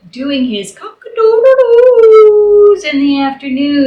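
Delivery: loud, high crowing cock-a-doodle; rooster crow. Acted. Narrator imitating Rooster Wooster's crowing, loud high crow.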